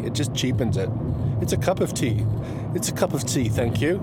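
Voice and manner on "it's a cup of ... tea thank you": higher, prim mock English accent. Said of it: "English people".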